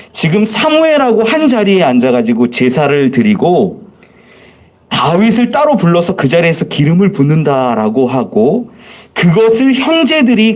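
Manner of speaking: usual speaking voice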